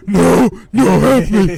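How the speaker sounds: high, loud mock cry for help; mock nightmare yelling. Retelling nightmares to a friend, mock panicked yelling. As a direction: yell it half-laughing, imitating your own panic. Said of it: "I wake up yelling".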